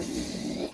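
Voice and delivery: hissy "pssh" of something squirted; imitated spray noise. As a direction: make a quick squirting sound effect. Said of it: "He must have, like".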